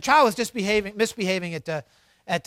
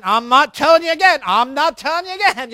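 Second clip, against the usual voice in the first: louder, stuck on the same threat; exasperated repeated warning; 3 semitones higher, 8 dB louder than usual. Retold or imitated. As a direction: repeat the warning with rising frustration. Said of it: "If you hear yourself saying".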